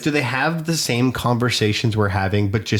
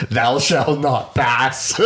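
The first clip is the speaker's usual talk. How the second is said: slow, grand wizard voice through laughter; Gandalf impression; 3 semitones higher, much slower than usual. Friend answers with a laughing Gandalf line, slow. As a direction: boom it like an old wizard. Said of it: "It was very Gandalf".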